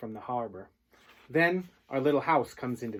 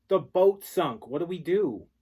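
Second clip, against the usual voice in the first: slow, loud, high desperate plea; urgent plea; 7 semitones higher, 8 dB louder than usual. Acted. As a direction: beg your father to act. Boy pleads with father.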